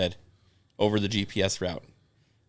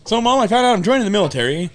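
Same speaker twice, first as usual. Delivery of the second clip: put-on higher, louder, fast kid blurting news; lightly voiced son announcing to his mother; mock teen announcement; 9 semitones higher, 5 dB louder, faster than usual. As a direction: play the son breezily telling Mom. Voicing a teen son to mom.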